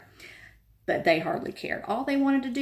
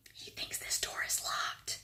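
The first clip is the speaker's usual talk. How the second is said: soft voiceless whisper; 10 dB quieter than usual. A narrator's reading. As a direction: whisper behind the door. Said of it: "said Harry, whispering".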